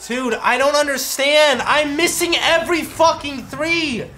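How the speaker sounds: frustrated rant. Real, not acted. Gamer ranting about missed threes, loud exasperation.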